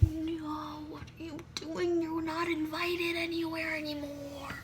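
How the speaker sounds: high, slow whispered quote; hushed mock scolding. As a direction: whisper the rejection. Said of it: "We're like".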